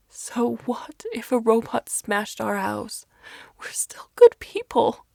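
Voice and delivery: breathy, broken sobbing whisper; mother sobbing on the floor. A narrator's reading. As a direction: cry the words out through tears. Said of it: "she sobs".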